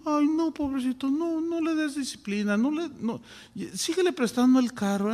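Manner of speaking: doting parent. Retold or imitated. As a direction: whine for the spoiled boy, overdone. Doting parent voiced in sermon.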